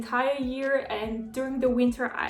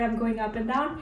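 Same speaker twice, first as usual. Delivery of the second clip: normal talking voice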